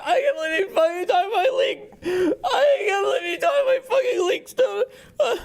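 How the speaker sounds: mock crybaby. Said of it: "This is what you sound like when you get fucking ass pained".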